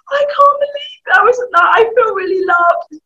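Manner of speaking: high, loud gushing mum, near tears; hammed-up overjoyed mum; mock tearful delight from a mum. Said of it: "They might not say it like that".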